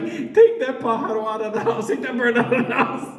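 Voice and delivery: mock old lady.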